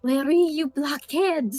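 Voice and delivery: slow, careful French accent. Shown as a demonstration. Voice actor answering a request, tentative French accent. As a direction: attempt the line in a French accent. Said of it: "with a French accent?".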